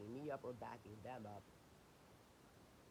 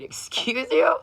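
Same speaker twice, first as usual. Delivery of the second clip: sharp high indignant retort; indignant excuse you; 10 semitones higher, 26 dB louder, much slower than usual. Retold or imitated. To the worker ordering her out, indignant high retort. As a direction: snap back, offended and incredulous.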